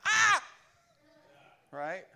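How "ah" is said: sudden high, loud startled scream; an unclean spirit caught off guard screams "Ah!"; demon yell. Acted. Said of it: "he just starts yelling".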